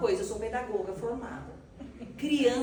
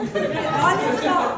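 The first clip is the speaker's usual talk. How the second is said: drawn-out, laughing louder call; 5 semitones higher, 11 dB louder, much slower than usual. Retold or imitated. Teasing mother-in-law about the grater, laughing. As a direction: laugh it out slowly.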